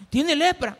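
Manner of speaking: loud, high startled cry; alarmed reaction. Acted. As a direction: exclaim like a shocked onlooker. Acts crowd's reaction to leprous hand.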